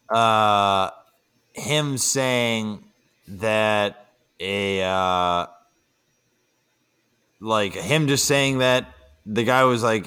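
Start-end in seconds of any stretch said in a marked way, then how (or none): none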